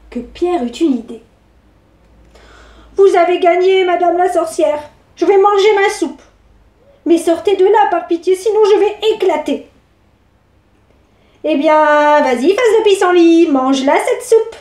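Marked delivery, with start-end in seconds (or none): 3.0-9.7 put-on raised, pleading child's voice; a boy begging, pitched up. Pierre pleads with the witch. 11.4-14.5 loud, mocking witch voice; a witch jeering, pitched up; taunting witch.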